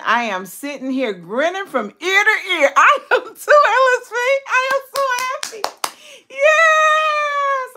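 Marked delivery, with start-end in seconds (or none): 2.8-7.7 high, loud, fast happy cry; almost tearful with joy; tearful happy squeal; overjoyed. Real, not acted. Overjoyed at viewer's return.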